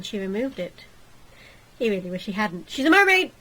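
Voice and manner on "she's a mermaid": high, loud alarmed cry; panicked shout. Acted. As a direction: yell it in shock. Shocked at seeing mermaid.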